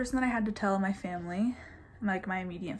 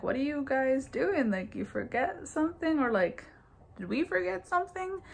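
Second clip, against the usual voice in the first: mock confusion; 6 semitones higher than usual. Sister's confused question retold.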